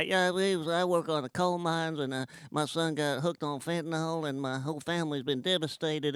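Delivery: a hint of a mountain accent, telling his sorrows; Appalachian coal miner. Comic imitating the Ozark coal miner's accent. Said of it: "you see a guy".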